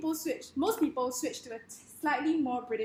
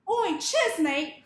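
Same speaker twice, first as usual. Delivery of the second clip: loud, high British slang greeting; British accent; 6 semitones higher, 7 dB louder, much slower than usual. Shown as a demonstration. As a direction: call it out in brash British. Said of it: "something like this in British".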